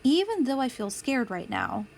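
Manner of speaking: ordinary delivery, nothing marked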